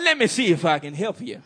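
usual speaking voice

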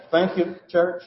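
usual speaking voice

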